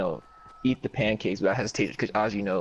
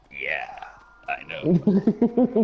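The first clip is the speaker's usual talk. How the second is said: Beatrice; 5 semitones higher, 4 dB louder, much slower than usual. Retold or imitated. Storyteller corrects his impression of the woman who served him, put-on character voice. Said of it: "Her voice was, like, more like".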